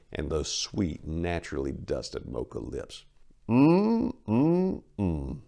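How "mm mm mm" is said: high appreciative mm mm mm falling; leering hum. Acted. Mayor eyeing Maria, leering high hum. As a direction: hum like tasting something.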